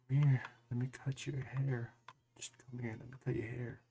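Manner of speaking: mocking a creepy tone with kids. Mimicking how his friend coaxes kids, soft creepy coaxing voice. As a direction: imitate him luring a child in a soft, creepy hush. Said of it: "let me go explain to you on what he does with kids".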